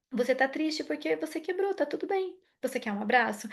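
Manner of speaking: suave e baixinho, acolhendo a criança triste; mãe acolhedora. Shown as a demonstration. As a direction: fale bem mais baixo, como quem consola um filho. Mãe acolhendo criança que chora. (mãe consolando o filho, voz baixa e suave)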